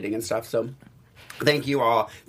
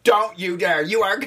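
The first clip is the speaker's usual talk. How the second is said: higher, insistent mock scolding; raised-pitch quoted command; retold bossy order; 6 semitones higher, slower than usual. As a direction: play the friend laying down the law, pitched up.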